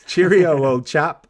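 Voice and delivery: mock British "Cheerio, old chap" through a laugh; bad British accent. Host greets his co-host, hammed-up bad British accent. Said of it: "wail on the British accent".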